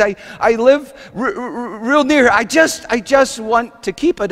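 high, stammering, tearful plea; weepy. Acted. Agnes pleads with Tony to keep the cake, weepy. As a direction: stumble over the words, overcome. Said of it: "she broke down weepy".

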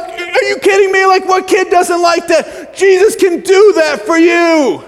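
high, loud, drawn-out hype; hammed-up climactic shout; mock amazement. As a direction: proclaim it like an overexcited children's preacher. Mock amazement and promise to kids.

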